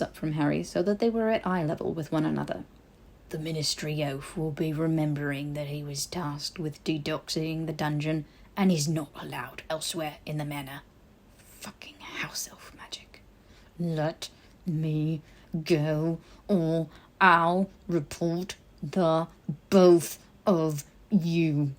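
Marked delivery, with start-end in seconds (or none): 3.3-10.8 put-on a disapproving old elf keeping order; stern house elf. Old house elf scolding Harry to keep quiet. 11.4-13.2 slow, soft whispered swearing; whispered curse. Acted. Body-bound Harry cursing elf magic in a whisper. 13.8-21.7 strained threat. Acted. Frozen Harry threatening the elf, strained.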